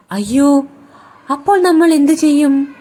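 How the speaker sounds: alarmed crocodile. A narrator's reading. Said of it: "മുതല ചോദിച്ചു".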